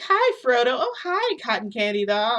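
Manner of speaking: high, bubbly visitor hello; mock-sweet visitor voice; imitated gushing greeting. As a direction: greet them in a high, loud singsong. Visitor greets baby and narrator.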